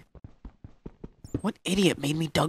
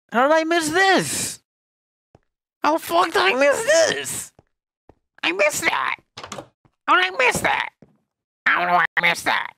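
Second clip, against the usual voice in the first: a much higher, loud put-on cartoon villain voice; bad Doofenshmirtz voice; 5 dB louder than usual. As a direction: squeak it out like a bad cartoon scientist. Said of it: "a really bad Doofenshmirtz".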